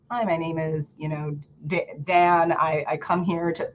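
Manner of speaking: quiet, halting mock intro; mock nervous speaker. Mocking a nervous speaker's quiet halting intro. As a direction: imitate a timid speaker fumbling.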